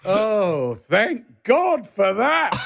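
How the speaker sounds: high, slow, exaggerated relief; hammed-up thank God, drawn out high; mock relief. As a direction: play the sighing relief of young actors mocking themselves. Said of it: "And we're like".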